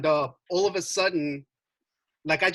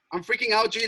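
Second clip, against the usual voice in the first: usual speaking voice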